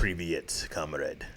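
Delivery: badly faked Russian comrade; mock Russian accent. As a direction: greet like a stage Russian. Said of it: "you have the accent".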